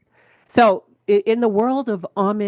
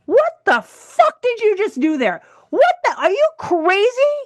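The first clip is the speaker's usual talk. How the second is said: high, loud, laughing outrage; hammed-up disbelief, laughing through it; mock outraged shout; 14 semitones higher, 4 dB louder than usual. Woman scolds her boyfriend at a wedding, loud laughing outrage. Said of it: "And I said".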